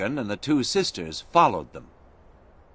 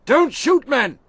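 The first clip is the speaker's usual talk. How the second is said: much higher, loud order; commanding shout; 5 dB louder than usual. A narrator's reading. General Monroe ordering his soldiers to stop firing, shouting.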